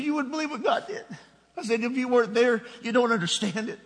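telling mom through mild tears; retold tearful telling. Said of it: "I started crying".

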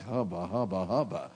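put-on a boy suddenly girl-crazy, just hinted; mock smitten boy. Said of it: "he's like".

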